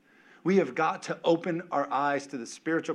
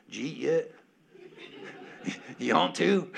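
Jeff Foxworthy; 2 semitones higher than usual. Retold or imitated. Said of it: "like Jeff Foxworthy".

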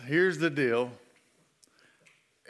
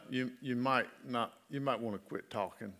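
soft inner voice; 3 semitones lower, 6 dB quieter than usual. Retold or imitated. Inner voice of the Spirit.

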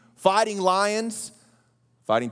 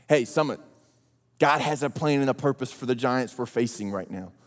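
ordinary talk

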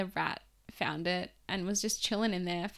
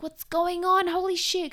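alarmed family; 9 semitones higher, 7 dB louder than usual. Retold or imitated.

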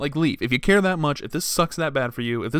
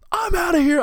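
much higher, loud, hammed-up goodbye; mock dramatic exit; 7 dB louder than usual. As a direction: storm out in a big pretend huff.

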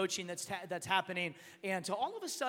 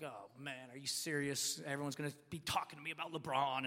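low, soft, deflated complaint; discouraged grumble; 6 semitones lower, 7 dB quieter than usual. Retold or imitated. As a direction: replay being let down by your team. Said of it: "I'm pretty, pretty discouraged".

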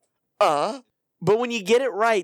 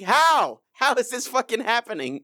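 exasperated outburst; 7 semitones higher than usual. Retold or imitated. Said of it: "I'm like".

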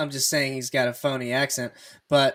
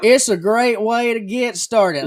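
slow, loud, higher put-on Cajun drawl; fake Southern accent; 7 semitones higher, 8 dB louder, slower than usual. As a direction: imitate a coach faking a Louisiana accent. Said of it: "I have never heard him talk like that".

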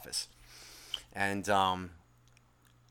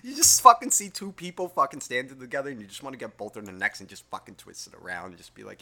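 ordinary talk, nothing marked

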